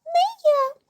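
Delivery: very high mouse squeak. A narrator's reading. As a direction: squeak out a tiny refusal. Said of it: "пищить круть".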